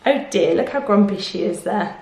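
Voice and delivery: usual speaking voice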